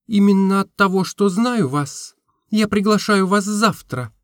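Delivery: usual speaking voice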